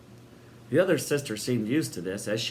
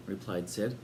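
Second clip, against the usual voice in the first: ordinary delivery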